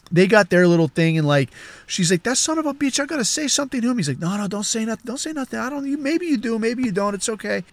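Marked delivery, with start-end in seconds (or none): 2.2-4.0 high, hurried mock indignation; indignant housekeeper. Housekeeper vowing to confront the helper, high indignant voice. 4.2-7.6 soft, higher, placating murmur; hushing boss. Retold or imitated. Boss talking housekeeper out of confronting, soft hushing voice.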